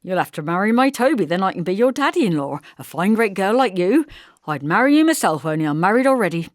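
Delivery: jovial teasing. Acted. Harry teasing Lissy loudly.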